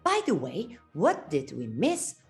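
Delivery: ordinary delivery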